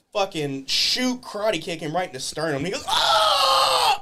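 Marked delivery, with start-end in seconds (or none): 2.9-4.0 very high, loud drawn-out yell; mock pained scream. Opponent takes karate kick to sternum, mock scream. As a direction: scream as if kicked in the chest. Imitating kicked opponent's cry.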